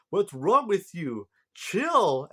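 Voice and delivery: high, loud, drawn-out telling-off; hammed-up alarm, slow and high; mock exasperated protest. Acting out Gold's startled reply.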